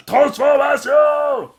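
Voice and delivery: high, loud, drawn-out battle cry; transformation shout. Retold or imitated. The monster turning into a bull, with a long loud battle cry. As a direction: bellow it as you transform.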